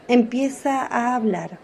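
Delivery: normal talking voice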